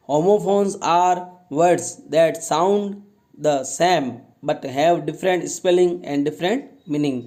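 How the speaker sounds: slow, clear read of the definition. Shown as a demonstration. Teacher reading the homophone definition slowly to class. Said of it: "teacher reads definition slowly".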